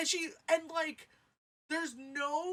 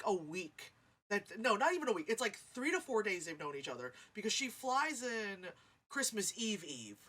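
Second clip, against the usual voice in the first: ordinary delivery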